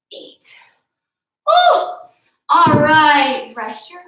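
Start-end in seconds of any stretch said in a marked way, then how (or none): 1.5-1.8 high, loud celebratory whoop; real whoop of triumph; excited whoop. Real, not acted. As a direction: cheer the end of the set. Cheering after finishing a set.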